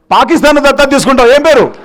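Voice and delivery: real emcee call; rallying call-out. Real, not acted. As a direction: call out the pledge for everyone to hear. Calling out to a volunteer.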